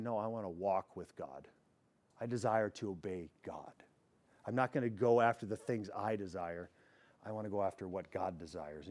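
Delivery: soft, hushed resolve, like a quiet prayer; gentle hushed commitment, eyes closed; soft inner vow. Pretend. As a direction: say it under your breath as a private promise to God. Believer's inner resolve voiced.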